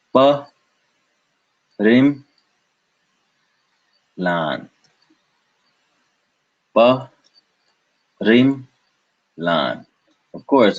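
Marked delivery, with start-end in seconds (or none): 0.1-4.7 "pa, rim" high, "lan" dropping, with gaps; syllable by syllable. Shown as a demonstration. Teacher separating a word, "pa, rim, lan" syllable by syllable. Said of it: "we could have separated this again". 6.7-9.9 "pa, rim" high, "lan" dropping, with gaps; syllable by syllable. Shown as a demonstration. Teacher separating a word, "pa, rim, lan" syllable by syllable. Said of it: "we could have separated this again".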